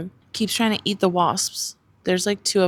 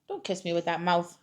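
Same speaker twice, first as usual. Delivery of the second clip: ordinary talk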